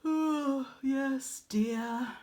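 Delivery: bored mother. Acted. Mother humouring boastful Arachne, bored and yawning. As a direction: humour her through a stifled yawn. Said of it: "her mother said, stifling a yawn".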